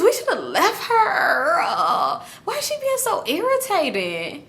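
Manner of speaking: hammed-up crying, high and slow; fake-sob the complaint; mock wail. The teller pretends to wail about the annoying friend, hammed-up. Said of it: "I'm just thinking to myself".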